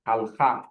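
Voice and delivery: halakha with the stress on the final KHA; hal-KHA, ending stressed; stress on the last syllable. Shown as a demonstration. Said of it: "the emphasis is in the last syllable".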